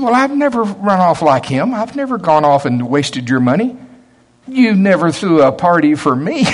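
upset elder brother. Retold or imitated. Elder brother complaining to his father, upset. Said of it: "the reason he was so upset".

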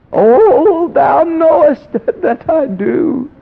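very high, loud, slow and breaking; eager crying plea. Retold or imitated. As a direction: overflow with longing as you answer God. Lenny eager answering God.

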